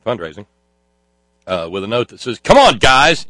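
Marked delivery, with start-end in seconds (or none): 2.4-3.3 louder and higher, mock shouted plea; all caps. Host reading donor's all-caps note aloud, mock shouting. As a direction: read the note like it's yelling in capitals. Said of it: "in all caps".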